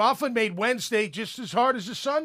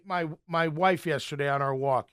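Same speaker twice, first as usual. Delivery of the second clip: ordinary talk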